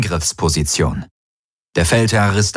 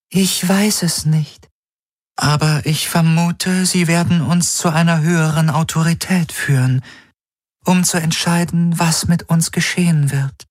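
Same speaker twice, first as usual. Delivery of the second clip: alien helix voice; 10 semitones higher than usual. Acted.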